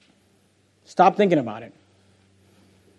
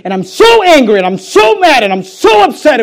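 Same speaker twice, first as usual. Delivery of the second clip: higher, louder angry outburst; retold anger; 8 semitones higher, 5 dB louder than usual. Widow rages about the hanging tree, higher and louder. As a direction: let her grief boil over, each so punched. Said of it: "woman tells of hanged family".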